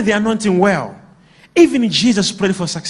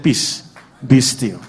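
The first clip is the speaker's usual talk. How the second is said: Jesus calming the storm; 9 semitones lower, slower than usual. Acted. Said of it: "he woke up and said".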